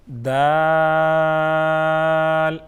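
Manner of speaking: دال held very long and loud; drawn-out letter name. Shown as a demonstration. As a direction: stretch دال slowly. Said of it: "با". (دال کو لمبا کھینچیں)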